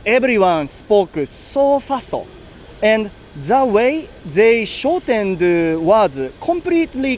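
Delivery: slow English with heavy Japanese accent; thick Japanese accent. Shown as a demonstration. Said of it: "in thick Japanese accent".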